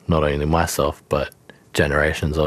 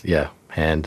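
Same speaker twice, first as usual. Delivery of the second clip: normal talking voice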